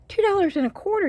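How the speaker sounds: high, quick thrilled exclamation; thrilled at how cheap it was; excited price. Real, not acted. Telling viewers the price, high and thrilled.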